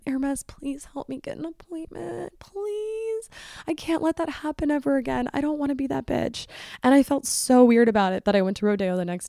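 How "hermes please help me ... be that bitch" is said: high, soft, whiny begging; hammed-up little plea; mock pleading. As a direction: beg in a small, squeaky voice. Mock plea to Hermes for appointment.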